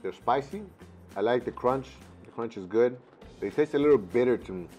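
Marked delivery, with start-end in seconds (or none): none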